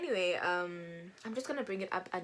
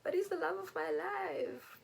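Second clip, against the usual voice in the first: dramatic lovestruck whine; 11 semitones higher than usual. Retold or imitated. Dramatic teen protest.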